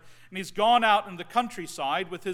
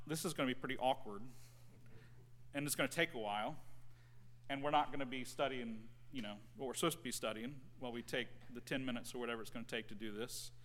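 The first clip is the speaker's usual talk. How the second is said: quick, soft aside of a retold thought; inner thought; 5 semitones lower, 12 dB quieter, much faster than usual. Student privately dreading the exercise, quick hushed inner thought. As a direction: rush it under your breath like a private worry. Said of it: "at first I thought".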